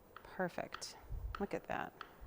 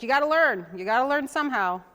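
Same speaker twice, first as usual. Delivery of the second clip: firm mom; 4 semitones higher, 8 dB louder than usual. Retold or imitated. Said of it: "She'd be like".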